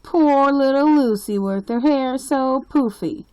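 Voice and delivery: high, loud singsong taunt; mock her like a schoolyard bully. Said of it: "Ralph continued".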